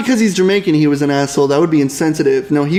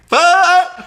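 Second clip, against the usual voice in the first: sudden high yelp, much higher; a quick squawk to startle grandpa; mock startled yell; 12 semitones higher than usual. Podcaster mock yelling at grandpa, sudden high yelp. Mock shout at old man.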